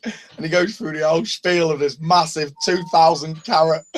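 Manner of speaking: ordinary delivery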